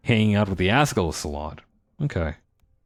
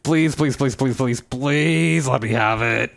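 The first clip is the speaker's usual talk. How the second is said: mock-begging, words drawn out toward the end; playful pleading; 4 semitones higher, slower than usual. Player begging the game for a wrestling belt, playful pleading. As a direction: whine playfully for a prize you badly want.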